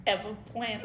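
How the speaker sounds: hiccuping through the words; holding back a hiccup; suppressing hiccups. Real, not acted. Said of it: "I have the hiccups".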